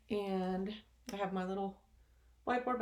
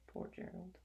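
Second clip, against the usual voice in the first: sympathetic aside; 12 dB quieter than usual. Real, not acted.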